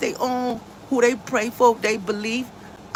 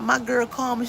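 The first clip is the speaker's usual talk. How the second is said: usual speaking voice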